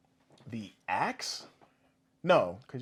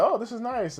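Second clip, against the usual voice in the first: high-pitched, sweet cartoon heroine; Moana; 10 semitones higher than usual. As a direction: lift it high like a friendly animated character. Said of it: "Moana's there, and she's like".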